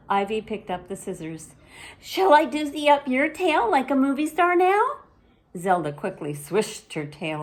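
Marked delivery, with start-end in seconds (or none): 2.0-5.0 high, louder eager offer; little sister wanting her turn. Acted. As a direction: offer it brightly. Ivy offers revenge.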